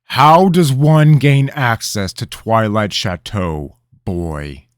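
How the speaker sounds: loud, high pointed question; king pressing the boy for access, demanding. Acted. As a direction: pin him down with it.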